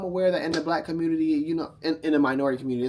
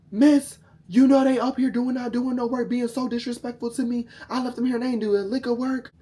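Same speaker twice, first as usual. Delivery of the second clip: complaining man; 10 semitones higher, 5 dB louder than usual. Retold or imitated. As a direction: whine to the mom on the phone. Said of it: "So he called my mom".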